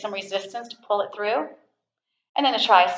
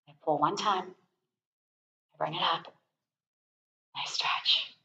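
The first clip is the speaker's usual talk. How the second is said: soft whisper; 4 semitones lower, 13 dB quieter, slower than usual. Real, not acted. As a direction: drop to a slow whisper. Soft cue during the stretch.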